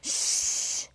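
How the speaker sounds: long, soft "shh"; storybook hush; long shushing. A narrator's reading. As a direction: hush everyone with a drawn-out hiss. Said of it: "said the chimpanzee".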